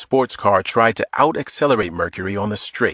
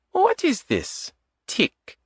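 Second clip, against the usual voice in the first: put-on puzzled question in a higher Eastern European accent; light Eastern European accent, puzzled; 4 dB quieter, slower than usual. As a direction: Adita not knowing the word, light Eastern European accent. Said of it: "In a light Eastern European accent".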